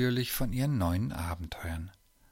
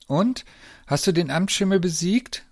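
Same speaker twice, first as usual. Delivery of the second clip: put-on eager, higher and louder child's question; very excited question; 8 semitones higher, 6 dB louder than usual. A narrator's reading. Lisa greets her uncle for the news, very excited. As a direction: ask it bursting to know. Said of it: "fragte sie ganz aufgeregt".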